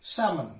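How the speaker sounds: SALMON as "sam-un", with no L; silent L. Shown as a demonstration. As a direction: leave the L out of SALMON. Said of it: "L is silent in these words".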